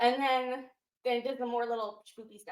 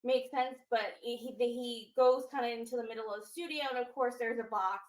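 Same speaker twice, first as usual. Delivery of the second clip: normal talking voice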